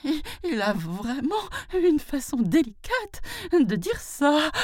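very high, stammering fright; terrified brahman. A narrator's reading. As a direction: stammer it high with knocking knees. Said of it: "ses genoux s'entrechoquaient de frayeur". (brahmane terrifié, voix très aiguë)

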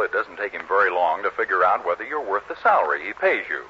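normal talking voice

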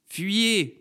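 high wailed warning; old prophetess raising the alarm; cry of warning. A narrator's reading. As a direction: call it out high and drawn out. Old courgette cries out a warning.

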